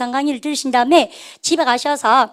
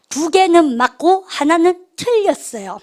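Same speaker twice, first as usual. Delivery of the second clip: slower, each phrase weighted; slow corrective emphasis; 5 semitones higher, 3 dB louder, slower than usual. Shown as a demonstration. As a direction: correct yourself with deliberate stress. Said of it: "다시 보십시다". (천천히 힘주어 정정하기)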